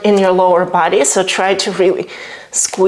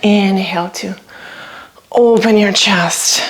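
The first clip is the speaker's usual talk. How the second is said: normal talking voice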